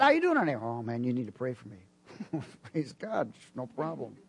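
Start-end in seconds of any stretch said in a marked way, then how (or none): 0.0-0.5 high, bright-pitched hello to a stranger; cheery greeting. Retold or imitated. Preacher greets a woman in Lowe's, cheery. 0.5-1.8 low, quiet downcast plea; glum reply. Retold or imitated. Troubled shopper asks preacher for prayer, glum.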